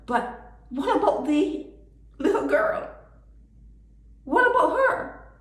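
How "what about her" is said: normal talking voice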